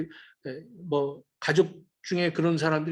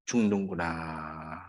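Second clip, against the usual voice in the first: 죽는구나 slow, much lower and softer, rising at the end; dying realization; 14 semitones lower, 8 dB quieter, much slower than usual. Acted. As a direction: let 죽는구나 sink low and slow, then lift. Prodigal realizes he will die.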